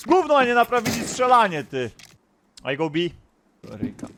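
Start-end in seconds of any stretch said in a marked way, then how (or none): 0.1-1.9 loud frustrated gamer rant; angry rant. Real, not acted. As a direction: vent loudly about bad shots. Raging about missed shots. (głośna, wściekła tyrada)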